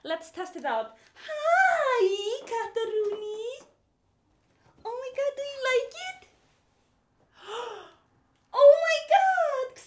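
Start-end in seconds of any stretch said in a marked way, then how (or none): 1.1-9.8 delighted, high-pitched fussing over a pet; cooing pet talk. Real, not acted. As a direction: coo and gasp at your cat. Coos at cat in its cave.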